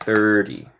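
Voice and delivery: "thirty" as "thur-dee", T tapped; relaxed American "thurdy"; American flapped T. Shown as a demonstration. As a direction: flick the T of "thirty" like a Spanish R. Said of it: "porque yo soy estadounidense".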